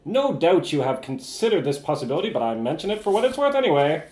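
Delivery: hammed-up old-timey Mid-Atlantic letter reading; old-timey Mid-Atlantic accent. Closing a tip letter to the FBI, old-timey Mid-Atlantic accent. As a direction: read it out with a theatrical Mid-Atlantic lilt. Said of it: "old-timey Mid-Atlantic accent".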